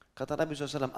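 ordinary delivery

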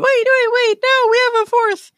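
loud, very high squeaky critter voice; Space Squirrel. Acted. Squirrel correcting the party head count, loud high squeak. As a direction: squeal it urgently like a small cartoon animal. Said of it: "and Space Squirrel goes".

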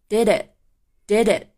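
"did it" run together with no break, slow; slow linked "did it" for learners. Shown as a demonstration. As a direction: join "did" and "it" into one sound. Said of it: "Did跟it可以连读".

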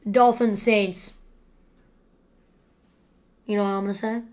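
ordinary talk

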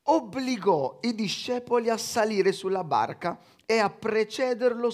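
measured Bible reading voice; slow, solemn reading from the pulpit; scripture reading. Acted. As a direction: read the Gospel out to the congregation. Reads the Gospel aloud.

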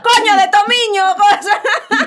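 loud, high, laughing outburst; excited exclamation. Real, not acted. Host marveling at the coincidence, loud laughing exclamation. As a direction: blurt it out in amused disbelief.

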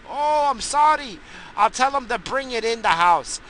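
lightly voiced apologetic neighbor. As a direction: mimic a sheepish neighbor saying sorry.

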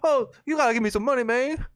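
high, fast pleading panhandler voice; begging homeless man. Retold or imitated. Homeless man hitting up office workers, high fast begging.

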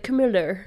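Camilla ending in an R, "Camiller"; imitating Charles. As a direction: say "Camilla" with an R tacked on the end. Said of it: "Charles is like".

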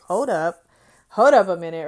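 loud, startled call to stop; retold alarmed hold up. Telling the story of the office clerk, a startled loud hold up.

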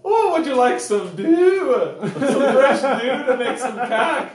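high, loud hammed-up Swedish accent. Offering someone fresh dew, Swedish accent. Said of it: "Do the Swedish?".

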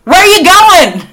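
high loud disbelieving call, "Where are you going?"; mock yell. Friend mock-shouting at Dorothy for running off, loud. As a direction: yell after her like a baffled viewer.

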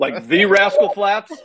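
slow, loud, stunned question; disbelieving. Retold or imitated. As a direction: ask it slowly in shock. Retold Dave's disbelief.

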